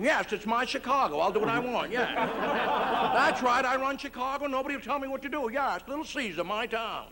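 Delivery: tough-guy mob boss boast; a hint of a tough gangster; Edward G. Robinson. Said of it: "dubbed in Edward G. Robinson".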